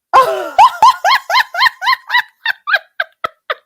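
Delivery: high loud cackling laugh; high mocking cackle. Real, not acted. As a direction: cackle at her. Laugh alone.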